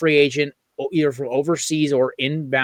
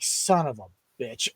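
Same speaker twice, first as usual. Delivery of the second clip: muttered curse; 3 semitones lower, 10 dB quieter, slower than usual. Retold or imitated. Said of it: "I was like".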